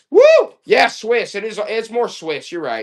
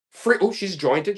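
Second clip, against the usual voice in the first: ordinary talk, nothing marked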